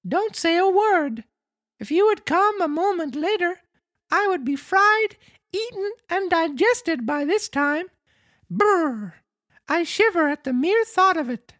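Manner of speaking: very high, squeaky puppet voice; Pinocchio, frightened. Acted. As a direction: play a scared little marionette way up high. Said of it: "still trembling with fright".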